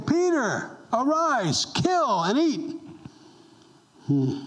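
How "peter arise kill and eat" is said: high, slow, solemn heavenly command; voice of God. Acted. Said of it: "And a voice came to him saying".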